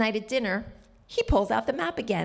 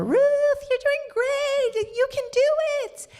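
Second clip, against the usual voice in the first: much higher, louder, drawn-out encouraging shout; cheering call; 4 dB louder, slower than usual. Retold or imitated. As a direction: call it out like a marathon crowd cheering her on. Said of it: "strangers would call out".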